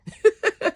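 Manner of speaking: short high burst of laughter; quick happy giggle. Real, not acted. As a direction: laugh with delight. Happy about smooth glossy accent.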